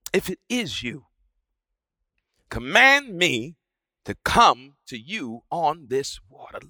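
soft, low plea to the Lord; quiet, tentative plea; hushed request. A narrator's reading. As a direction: ask it almost under the breath. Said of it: "he says".

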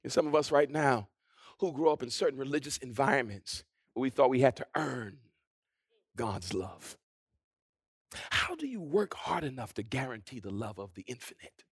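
low, soft, reflective sermon delivery; subdued, searching preaching; hushed preaching. Acted. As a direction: pose it quietly to the congregation. Preacher continues in a hush.

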